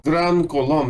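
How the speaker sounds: put-on "Gran Colombia" in a light Russian accent. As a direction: announce it like a Russian. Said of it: "I spoke like a Russian".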